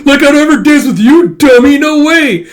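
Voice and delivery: hammed-up shrieking boy; tsundere screaming. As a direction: scream it in flustered denial. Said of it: "just runs off screaming".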